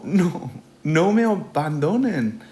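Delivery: put-on high, loud, desperate cry of a baby penguin; baby's frantic plea. A narrator's reading. Baby Paco begs his parents not to leave, high and desperate. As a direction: beg them not to leave you. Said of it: "And he exclaimed".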